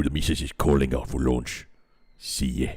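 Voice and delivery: low, gruff orc voice. Acted. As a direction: grumble it like an orc. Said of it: "be read in an orc voice".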